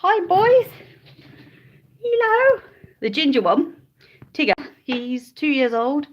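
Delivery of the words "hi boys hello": high, sing-song greeting to the pets; pet talk. Real, not acted. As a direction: coo hello to the guinea pigs. Greeting the guinea pigs.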